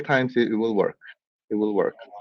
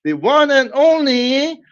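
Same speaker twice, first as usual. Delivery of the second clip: high, slow, drawn-out boxing announcer build; ring announcer; 9 semitones higher, 3 dB louder, slower than usual. Pretend. Host introducing the challenger to viewers, booming ring announcer call. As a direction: stretch each word like a fight-night MC.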